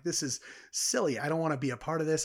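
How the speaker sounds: recalling being fed up with a mandatory graduation; exasperated complaint. Retold or imitated. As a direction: scoff at the whole silly ceremony.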